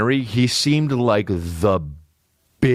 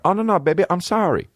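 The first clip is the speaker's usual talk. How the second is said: put-on pleading boyfriend; 9 semitones higher, faster than usual. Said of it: "me going like".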